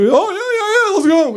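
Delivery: high-pitched, over-eager mock yes; eager friend agreeing.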